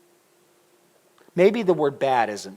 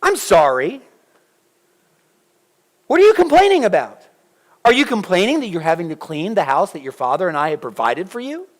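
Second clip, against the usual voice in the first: loud, high sarcastic mom lecture; Stephanie scolding; 4 semitones higher, 7 dB louder than usual. Retold or imitated. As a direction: pile on the scolding, rising as it goes. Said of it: "I can just hear her say it".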